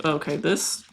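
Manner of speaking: ordinary talk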